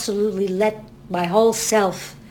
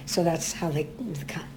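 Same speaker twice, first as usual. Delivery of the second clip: hushed aside; 4 semitones lower, 9 dB quieter than usual. Real, not acted. Hushed after mentioning cancer.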